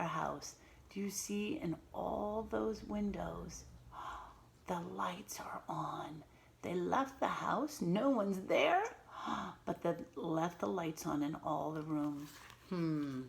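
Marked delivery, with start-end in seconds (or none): 3.7-4.3 soft, breathy surprised gasp; mock gasp. Lights left on in house. 8.9-9.6 short, soft gasp of mock dismay; a small shocked gasp for the class; teacher gasping at wasted energy. Nobody home, lights on.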